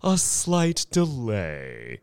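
slow, high, drawn-out announcement; unctuous, stretched-out delivery; smooth showman. A narrator's reading. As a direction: break it to them slowly and oilily. Thurkell breaks bad news to ladies.

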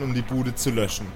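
ordinary talk

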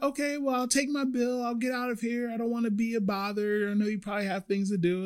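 high, soft, not wanting to be a bother; polite customer. Retold or imitated. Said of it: "she was like".

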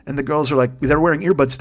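usual speaking voice

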